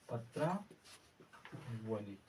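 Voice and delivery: पत्रावली drawn out slowly and quietly; very slow soft dictation. Shown as a demonstration. Teacher dictating the correct word slowly to students. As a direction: stretch पत्रावली, every syllable separate. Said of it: "पत्रावली होता है".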